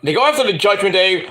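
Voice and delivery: ordinary talk, nothing marked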